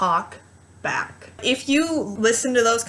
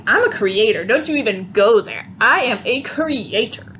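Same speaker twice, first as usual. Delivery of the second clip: sassy, emphatic comeback with swooping pitch. Shown as a demonstration. Woman talking back to her inner voice, sassy. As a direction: say it with sass, shutting someone down. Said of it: "I say my word sassy".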